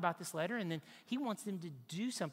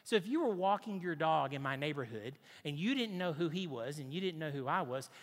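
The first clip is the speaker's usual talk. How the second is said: ordinary delivery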